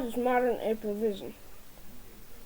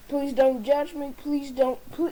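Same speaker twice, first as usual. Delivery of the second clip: normal talking voice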